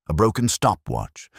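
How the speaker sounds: usual speaking voice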